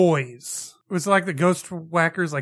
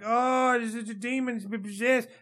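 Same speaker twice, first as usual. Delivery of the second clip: higher, run-together mock babble; mock rambling; 5 semitones higher than usual. Mimicking the ghost hunter in the water, mock rambling. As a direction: gabble the line as a mocking imitation. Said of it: "she's just rambling".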